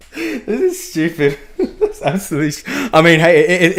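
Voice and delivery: cracking up at a silly name; laughing. Real, not acted.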